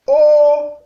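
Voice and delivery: O on a high, level, loud tone. Shown as a demonstration. Teacher showing the tones, O on high tone. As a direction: hold O high and even. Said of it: "ton haut".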